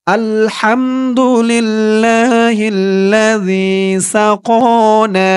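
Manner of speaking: slow, drawn-out chanted recitation; recited Arabic dua. Shown as a demonstration. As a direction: stretch every syllable like tilawat. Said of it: "আপনারাও আমার সাথে বলুন". (ধীর সুরেলা তিলাওয়াত)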